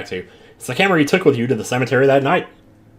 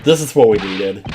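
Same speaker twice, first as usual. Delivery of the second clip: gleeful drawn-out; 5 dB louder, much slower than usual. Real, not acted. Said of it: "gleeful anticipation".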